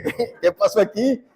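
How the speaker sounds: high, slow, comically startled question; mock alarm with a laugh in it; retold fright. Preacher retells his shock at his son's changed voice, hammed-up fright. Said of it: "Y yo me asusté".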